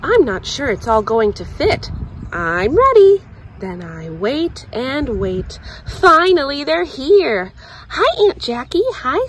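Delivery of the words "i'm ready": high, loud and bursting; eager. Acted. Girl waiting for the camping trip, high and eager. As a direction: announce it with a bounce.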